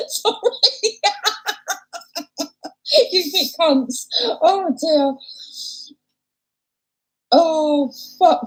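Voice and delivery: laughing so hard the words break up; can barely get the words out through laughter; helpless laughter. Real, not acted. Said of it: "I'm actually crying now".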